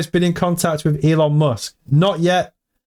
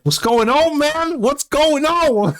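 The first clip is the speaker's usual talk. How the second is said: high, drawn-out Canadian accent impression with a laugh; 12 semitones higher, slower than usual. Streamer imitating a viewer's Canadian accent, high and laughing. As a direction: ham up a friendly Canadian greeting. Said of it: "He has the most Canadian accent ever".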